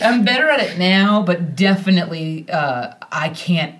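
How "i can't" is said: plain reading voice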